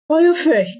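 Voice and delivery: "Maya Fey" jumps up high in alarm; startled reaction. Acted. Old lawyer jolted by hearing "Maya Fey". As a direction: flinch at the name "Maya Fey". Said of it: "What was that strange reaction?".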